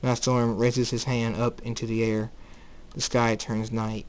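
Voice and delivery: ordinary delivery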